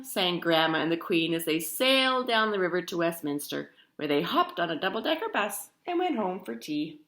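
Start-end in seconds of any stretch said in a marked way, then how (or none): none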